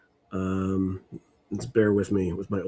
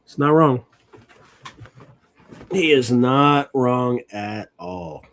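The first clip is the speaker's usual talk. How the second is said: slow, louder, leaning on every word; emphatic agreement; 3 semitones higher, 6 dB louder, much slower than usual. Real, not acted. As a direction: drive home that he is right. Streamer emphatically agrees with clip.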